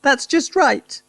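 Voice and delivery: high, pleased wife voice; wife pleased at the right height; relieved Missus Cranky. Acted. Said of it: "cried Missus Cranky".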